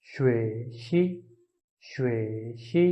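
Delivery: "श्वे शी" said slowly with a rising end; slow Chinese demo. Shown as a demonstration. Teacher slowly modelling the new word. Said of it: "इसकी pronunciation होगी".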